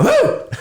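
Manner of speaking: squealing country whoop; high whoop. Retold or imitated. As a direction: let out a high woo. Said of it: "I created this character".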